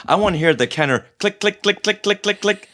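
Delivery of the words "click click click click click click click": rapid, evenly spaced tongue-tip clicks; imitated toy clicking. Imitating an old figure's head turning, crisp mechanical clicking. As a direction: click like a toy's ratcheting joint.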